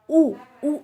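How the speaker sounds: drawn-out rounded ऊ sound; ऊ said with rounded lips, held; demonstrating the vowel. Shown as a demonstration. Teacher sounding out ऊ for students. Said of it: "ऊ आप बोल के देखिए".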